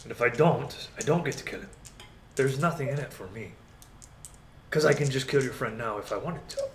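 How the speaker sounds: measured villain bargaining; lightly voiced menacing negotiator; Strahd. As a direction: weigh the wager with unhurried authority. Game master plays Strahd bargaining.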